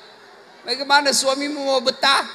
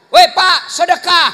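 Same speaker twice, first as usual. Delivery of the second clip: wife reminding; 5 semitones higher, 8 dB louder than usual. Retold or imitated. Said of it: "ingatkan suruh sedekah".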